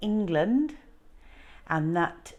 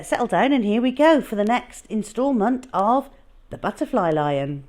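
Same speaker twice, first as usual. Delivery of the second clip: usual speaking voice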